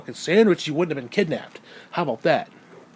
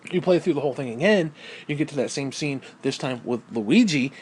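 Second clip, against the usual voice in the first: usual speaking voice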